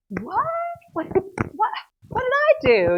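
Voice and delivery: high, stammering disbelief; stammer, then burst out loud and high; stunned protest. Retold or imitated. David reacts to being sent away.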